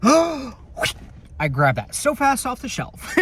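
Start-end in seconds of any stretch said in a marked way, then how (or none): 0.0-0.5 high excited gasp of joy; delighted surprise. Retold or imitated. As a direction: gasp like finding treasure.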